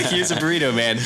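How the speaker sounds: high, giggling line; laughing. Retold or imitated. Storyteller cracking up voicing the hipster. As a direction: laugh through the hipster's offer.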